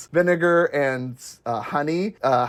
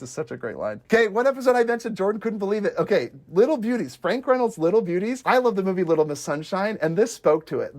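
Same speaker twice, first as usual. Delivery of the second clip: ordinary talk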